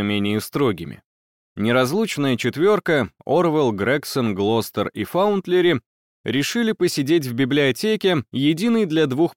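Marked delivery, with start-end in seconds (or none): none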